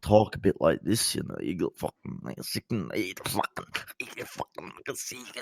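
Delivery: very soft, muttered Scottish swearing; mumbling Scottish accent. Acted. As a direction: mumble away like a sweary Scotsman. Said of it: "You're playing a Scotsman with mumbling Tourette's".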